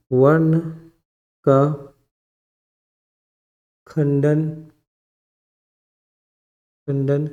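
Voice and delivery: word by word, long gaps between; dictation. Real, not acted. Teacher dictating a grammar rule, slow dictation. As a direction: dictate slowly for note-taking.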